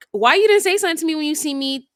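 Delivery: imitating an indignant confrontation; retold confrontation. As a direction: fire off an acted accusing question. Acting out her confronting him.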